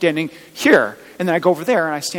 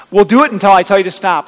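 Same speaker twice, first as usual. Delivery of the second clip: louder mock trainer command, clipped and final; playful louder trainer order; mock drill-trainer bark; 3 semitones higher, 10 dB louder than usual. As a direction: throw it out like a tough gym coach, half in jest. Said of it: "And the trainer said".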